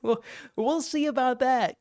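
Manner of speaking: high, slow, mocking "we'll see"; smug threat. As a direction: tease it like a bully. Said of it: "And Donald Trump says".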